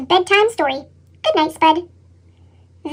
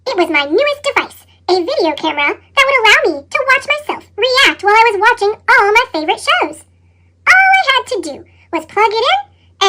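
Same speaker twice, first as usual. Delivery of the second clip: ordinary delivery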